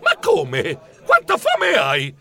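high, alarmed protest; startled. A narrator's reading. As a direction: jump in shock at the cousin's order. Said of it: "Io sobbalzai".

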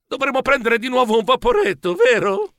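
high, plaintive question; high-pitched worried plea; sighing worry. Acted. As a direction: ask it with anxious dread between strides. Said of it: "Tra una falcata e l'altra sospirai".

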